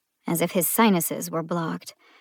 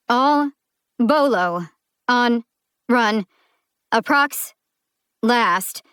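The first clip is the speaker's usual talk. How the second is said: broken dispatcher words, higher and louder; clipped bursts through static; choppy radio; 4 semitones higher, 5 dB louder, slower than usual. Acted. Female dispatcher over choppy police radio. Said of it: "half the words drowning in radio static".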